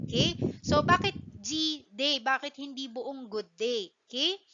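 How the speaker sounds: ordinary talk, nothing marked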